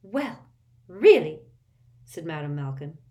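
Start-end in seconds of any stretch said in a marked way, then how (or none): 0.0-1.4 indignant huff. A narrator's reading. Shopkeeper indignant after customers leave.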